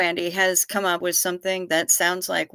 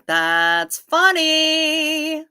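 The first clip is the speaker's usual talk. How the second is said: higher, louder and very slowly drawn out; slow mock amusement; 8 semitones higher, 5 dB louder, much slower than usual. Reacting to a chatter's joke, slow mock amusement. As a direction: stretch it out in mock delight.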